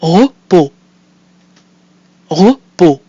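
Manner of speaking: Repos said loud and crisp in French; careful French. Shown as a demonstration. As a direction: clear French Repos, open O at the end. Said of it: "I'll say the word twice".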